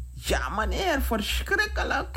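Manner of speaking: high-pitched cry without loudness; mock scream. Frightened King answers the man about the fire, mock shrieking. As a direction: act out a restrained, high shriek. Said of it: "gilde King".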